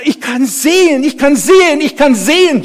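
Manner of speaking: high, loud cries of joy; aufgeregtes Schreien. Acted. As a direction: cry it out over and over. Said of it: "schrie ich immer". (jubelnd geschrien)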